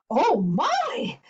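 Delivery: put-on high-pitched startled old woman; startled cry. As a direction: cry out in storybook fright. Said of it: "cried the tiny old woman".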